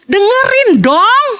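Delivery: slow, high, drawn-out plea; pleading scold. Real, not acted. Speaker scolding someone on phone in a meeting, slow high plea. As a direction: drag it out, begging attention.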